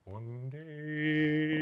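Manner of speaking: quiet echo of the chant; hushed catchphrase. Pretend. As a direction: drop it to a secret hush. Repeated catchphrase, hushed take.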